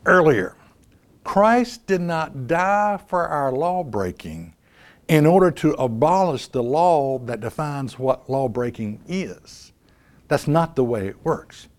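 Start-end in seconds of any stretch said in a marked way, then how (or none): 1.3-9.7 slow and soft. Shown as a demonstration. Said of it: "as I said earlier".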